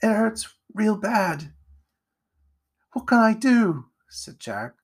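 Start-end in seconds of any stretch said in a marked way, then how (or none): 0.0-1.5 high, slow, pained plaint; tearful pain. A narrator's reading. Wounded Edgar to Jack, slow and tearful. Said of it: "tears welling up in his eyes". 2.9-3.9 high, loud, helpless plea; panicked helplessness. A narrator's reading. Jack kneels over wounded Edgar, panicked.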